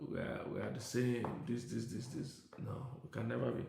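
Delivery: ordinary talk, nothing marked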